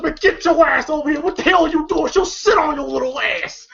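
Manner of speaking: high-pitched retold telling-off; mock scolding. Acted scolding during a scuffle.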